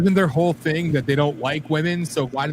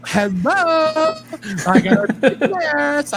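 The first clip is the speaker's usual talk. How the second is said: high, loud mocking effeminate voice; 8 semitones higher, 5 dB louder than usual. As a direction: camp it up to mock them. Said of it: "make you talk".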